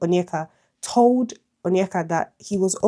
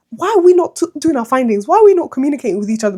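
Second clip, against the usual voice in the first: louder, higher and fast rant; exasperated; 5 semitones higher, 7 dB louder, faster than usual. Real, not acted. Storyteller venting at the family for not talking, exasperated rant. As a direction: fire off the questions in frustration.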